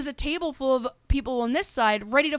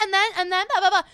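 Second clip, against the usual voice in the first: raised-pitch mock chatter; hammed-up blah-blah chatter; mock jabbering woman; 7 semitones higher, 3 dB louder, faster than usual. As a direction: imitate someone prattling on, higher and louder. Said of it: "she was like".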